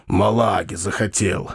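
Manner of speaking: low grumble; grumbling. A narrator's reading. Bakhcheev complains about Foma's drink, grumbling under his breath. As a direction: grumble half to yourself, pitch down. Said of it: "проворчал он чуть не вслух".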